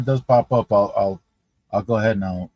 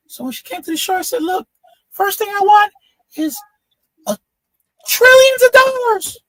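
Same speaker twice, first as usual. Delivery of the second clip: very high squeaky voice, louder on the wishes; high-pitched little guy; 4 dB louder than usual. Retold or imitated. Tiny-headed man recounting his wishes, high squeak. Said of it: "The guy has a really high-pitched voice".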